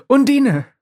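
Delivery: high, loud call of her name. Acted. Pablo calling out to Undine, high and loud. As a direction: call her across the void.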